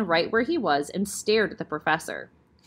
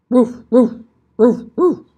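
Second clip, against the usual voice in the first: much louder growling woofs; big angry dog bark; 4 semitones higher, 12 dB louder, slower than usual. Acted. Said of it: "in the deep growling voice of a large, angry dog".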